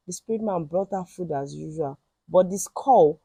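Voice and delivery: ordinary delivery, nothing marked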